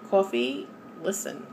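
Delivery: a touch of New York accent on "coffee". Real, not acted. Talking to fellow coffee drinkers, a light New York accent. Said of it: "there's my New York accent coming out".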